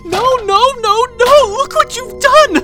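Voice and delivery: high, loud, crying wails of dismay; sobbing shrieks over the spilled mess; tearful outburst. Acted. Caretaker wailing at her captive pet over the mess, crying and shouting.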